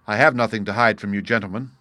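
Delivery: usual speaking voice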